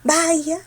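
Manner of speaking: "Bahía" said as BÁI-a, high on the first A; stress on first A. Shown as a demonstration. Teacher testing a wrong option, first A stressed in Bahía. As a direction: hit the first syllable of "Bahía", gliding over the I. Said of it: "¿Pero quién tiene la fuerza?".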